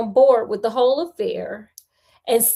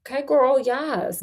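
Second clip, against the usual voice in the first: ordinary delivery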